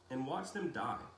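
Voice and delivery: plain reading voice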